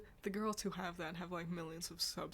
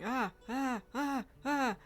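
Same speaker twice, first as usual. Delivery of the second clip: repeated short high yelps, louder; sharp startled ah cries, replayed for laughs; retold startled yelps; 6 semitones higher, 7 dB louder, slower than usual. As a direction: reenact the jumpy "ah ah" at the clank. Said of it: "just going".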